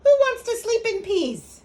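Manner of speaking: high, loud comic bafflement; exclaiming. A narrator's reading. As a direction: protest the absurd idea as a kitten. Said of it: "exclaimed Scapito".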